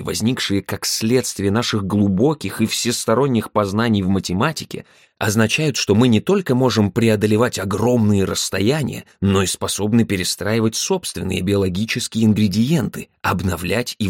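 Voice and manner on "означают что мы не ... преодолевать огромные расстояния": usual speaking voice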